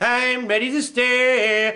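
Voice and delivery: slow, drawn-out cartoon bear voice; blue bear. Blue bear reporting ready for battle, slow drawl. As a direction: drag it out with a long last word. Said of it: "Blue's ready".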